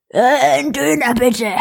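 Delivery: a hint of an old man mumbling his order; old villager. Retelling the old villager ordering at the kebab shop, lightly voiced old man.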